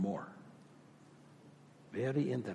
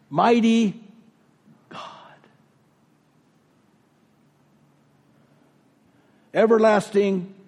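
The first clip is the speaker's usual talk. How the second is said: rising, ever louder scripture proclamation; a preacher proclaiming the prophecy to the congregation, swelling louder; building proclamation; 6 semitones higher, 4 dB louder than usual. Acted. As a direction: build it to a ringing Mighty God. Proclaims Isaiah nine.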